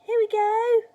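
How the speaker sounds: put-on high, loud boyish call; cried out. A narrator's reading. George about to give Grandma the spoon, crying out. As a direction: cry it out with excitement. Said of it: "George cried out".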